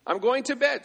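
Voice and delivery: put-on very high, slow wailing child voice; imitating a tantrum; mock crying toddler. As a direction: sulk and wail like a kid. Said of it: "Starts crying again".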